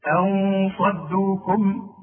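أن صدوكم stretched slowly with the nun hidden in a nasal hum; إخفاء بغنة; slow tajweed recitation. Shown as a demonstration. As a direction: draw out أن صدوكم, hide the nun before the sad. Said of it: "شوف".

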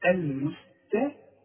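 المستقيم slow, light t then heavy q; slow careful syllables. Shown as a demonstration. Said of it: "القاف من الحروف المفخمة". (المستقيم ببطء، تاء مرققة وقاف مفخمة)

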